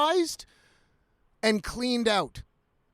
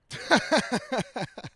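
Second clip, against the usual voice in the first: chuckle at own joke; 3 semitones higher than usual. Real, not acted.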